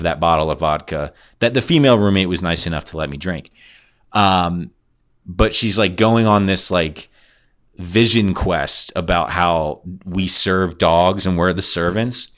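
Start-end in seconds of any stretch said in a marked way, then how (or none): none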